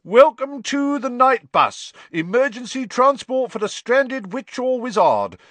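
high, loud, brash conductor's patter; loud bus conductor. A narrator's reading. Conductor greeting a stranded wizard, loud and high. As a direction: announce the bus like a showman. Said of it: "began to speak loudly".

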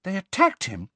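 high, aghast question; shocked. A narrator's reading. Hermione reacts to the attack on Firenze, shocked. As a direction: ask in high-pitched disbelief. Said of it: "sounding shocked".

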